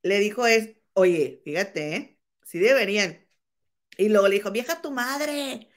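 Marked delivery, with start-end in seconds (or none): 4.5-5.7 higher, drawn-out mock yell; mock retold yell. Retelling the woman's insult to Mayeli, mock yell. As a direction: yell it slowly without extra volume. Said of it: "le gritó a Mayeli".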